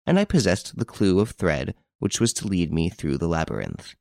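ordinary delivery, nothing marked

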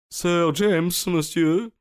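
high, grave announcement from the butler; solemn butler. A narrator's reading. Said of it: "s'écria-t-il d'une voix solennelle".

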